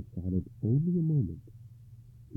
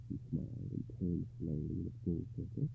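ordinary delivery, nothing marked

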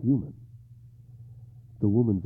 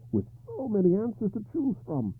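higher, pleading king in despair; 4 dB louder than usual. Acted. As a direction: ask with rising despair. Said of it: "he asked in despair".

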